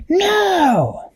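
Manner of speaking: very high shouted no; villain's shout. A narrator's reading. Blue Meanie lifted into the air, high shout. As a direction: yelp it high as he's lifted. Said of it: "The Blue Meanie went".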